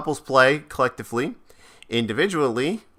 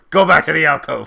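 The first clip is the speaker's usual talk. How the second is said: louder, higher mock telling-off; mock scolding order; 4 semitones higher, 6 dB louder than usual. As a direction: order them away in mock anger. Acting out a scolded character scene.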